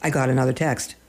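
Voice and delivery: ordinary delivery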